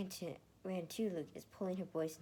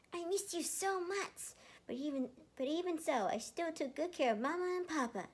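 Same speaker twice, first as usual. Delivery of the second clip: sweet little brother talking up to him; little boy; 12 semitones higher, 5 dB louder than usual. Acted. Emil hugging his big brother, in a high childlike voice. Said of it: "Emil giggled".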